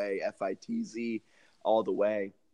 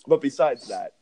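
ordinary talk

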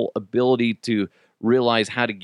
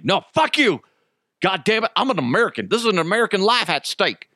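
mock outraged American tirade; high-pitched pretend rant, speeding up at the end; indignant asshole; 7 semitones higher, faster than usual. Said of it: "like, of an asshole".

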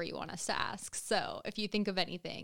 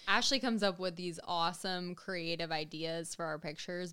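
ordinary delivery

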